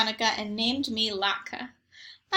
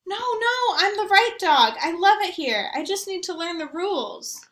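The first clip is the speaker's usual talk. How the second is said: higher, louder eager plea; pleading dog; 7 semitones higher, 5 dB louder than usual. A narrator's reading. Latka pleading with family not to be sent back, higher eager voice. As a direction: beg earnestly to stay.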